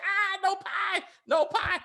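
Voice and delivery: high, slow squeal of "no pie"; hammed-up girl wailing no; high mock refusal. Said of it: "She should have been like".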